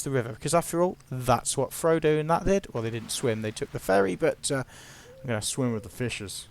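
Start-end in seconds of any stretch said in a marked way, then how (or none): 5.2-6.5 bad mock American gangster line, lower; terrible American accent. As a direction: attempt an American accent, badly and softly. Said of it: "terrible accent".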